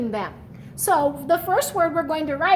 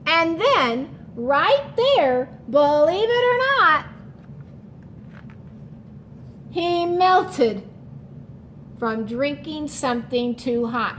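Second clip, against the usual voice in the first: put-on reading to children; 4 semitones higher than usual. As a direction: tell the story with big excitement for the class. Reads picture book to class.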